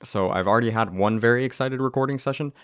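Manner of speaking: ordinary talk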